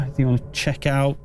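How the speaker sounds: ordinary delivery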